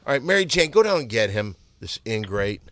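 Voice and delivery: usual speaking voice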